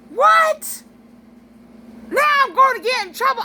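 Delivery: loud, high, whiny mock outrage; over-the-top high-pitched sibling whine; annoyed brother. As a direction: imitate a brother squawking indignantly at his sister. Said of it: "This is what he did".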